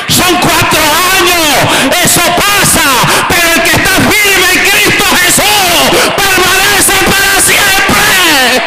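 high, slow, shouted sermon climax; preaching at full force. Acted. Pastor shouting to the church to stand firm in Christ. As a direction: drive every word home to the crowd.

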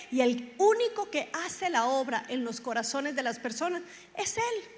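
loud, forceful preaching; emphatic, projected sermon delivery. Real, not acted. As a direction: exhort the congregation with full voice.

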